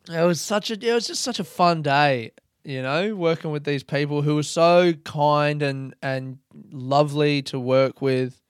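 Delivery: usual speaking voice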